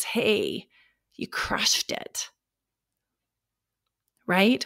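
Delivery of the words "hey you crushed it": whisper. Pretend. Future self congratulating you.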